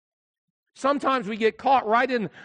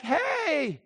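mock yell back; 8 semitones higher, 4 dB quieter than usual. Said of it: "I'm yelling back".

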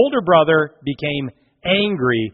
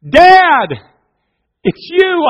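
put-on 1 joyful child; 9 semitones higher, 8 dB louder than usual. Trapped son hears his father.

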